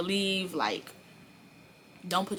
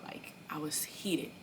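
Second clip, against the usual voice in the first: whisper; 8 dB quieter, slower than usual. Real, not acted.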